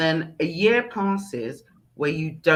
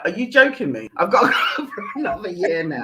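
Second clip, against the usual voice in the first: laughing disbelief; 5 semitones higher, faster than usual. Retold or imitated. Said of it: "I was like".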